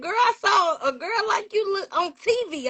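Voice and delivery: high-pitched granny impersonation; excited granny. Retold or imitated. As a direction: imitate granny amazed at what she saw. Granny's excited discovery, acted out.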